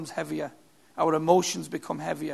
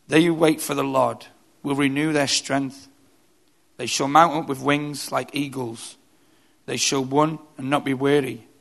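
scripture reading; 3 semitones lower than usual. Acted. Preacher reading Isaiah to his church, solemn. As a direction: read the verse with solemn weight.

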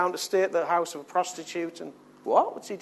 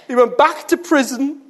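loud exclamation; 10 semitones higher, 9 dB louder than usual. Acted. Preacher's climax cry.

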